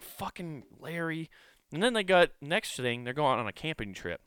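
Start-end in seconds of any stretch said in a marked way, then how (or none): 0.0-1.3 muttered curse. Retold or imitated. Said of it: "I'm like".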